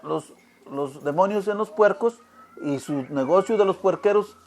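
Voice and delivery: usual speaking voice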